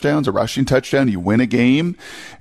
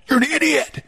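high-pitched mock outrage of an online fan; Twitter voice; 13 semitones higher than usual. Radio host mocking fans' social media replies in a high Twitter voice. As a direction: put on a goofy, much higher angry-commenter voice. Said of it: "that's my Twitter voice".

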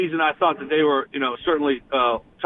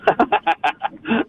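short burst of helpless chuckling; real laugh; 4 semitones higher than usual. Real, not acted. Guest laughing at the host's question about fans, amused chuckle. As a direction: laugh before trying to answer. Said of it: "I'm laughing because".

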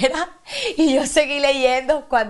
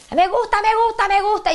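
imitating a singer's shout; 11 semitones higher than usual. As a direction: belt it like a singer screaming live. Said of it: "[name] dando gritos".

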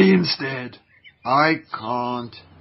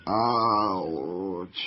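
pained groan; 6 semitones lower, 5 dB quieter than usual. Acted. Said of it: "You're groaning as if you have a toothache".

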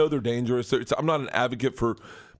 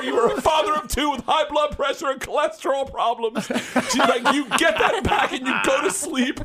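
much higher, louder mock scolding; scolding wife; 11 semitones higher, 3 dB louder than usual. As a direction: imitate the wife chewing him out. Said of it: "she goes".

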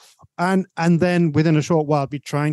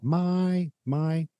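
"My" said high up and softly; "my" placed high in tone, softer; 4 semitones higher, 5 dB quieter than usual. Shown as a demonstration. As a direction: pitch the word "my" up high, lightly. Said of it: "it's kind of high up".

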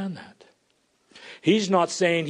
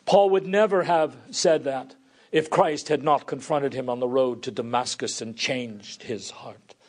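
usual speaking voice

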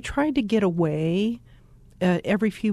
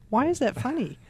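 higher-pitched baffled question; retold puzzled question; 5 semitones higher than usual. Wife asking her laughing husband in bed, puzzled. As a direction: ask it as a mock-baffled spouse. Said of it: "I would ask".

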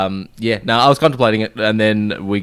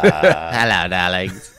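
campy drag queen; 3 semitones lower, much slower than usual. Acted. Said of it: "Call me Bubbles, darling".

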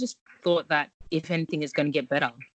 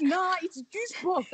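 put-on higher, slow child's voice; niece voice; 14 semitones higher, 5 dB quieter than usual. Cousin's kid correcting auntie in a high slow kid voice. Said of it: "she'd be like".

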